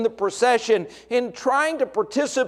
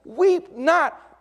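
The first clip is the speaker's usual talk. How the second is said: Jesus commanding; 8 semitones higher than usual. Acted. Jesus speaking to the widow at the procession, high ringing command. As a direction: proclaim it over the widow. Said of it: "and he says".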